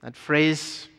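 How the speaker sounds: normal talking voice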